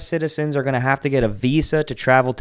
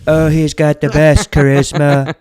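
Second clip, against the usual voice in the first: loud, high mock Trump boast; hammed-up Trump voice; Trump impression; 5 semitones higher, 10 dB louder than usual. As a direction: puff up like Trump bragging.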